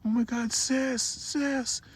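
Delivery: very high, slow panicked wail; screaming, crying. Retold or imitated. Boy running to his sister, wailing in panic, very high. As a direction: wail for your sister in terror. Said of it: "screaming, crying".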